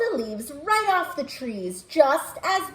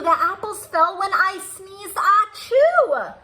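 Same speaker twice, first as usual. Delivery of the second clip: higher, bear's protest ending in a loud sneeze; bear insisting; 6 semitones higher, 4 dB louder than usual. Acted. Bear telling wind he caused it, higher with loud sneeze. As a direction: play the bear blaming his sneeze. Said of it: "Bear said".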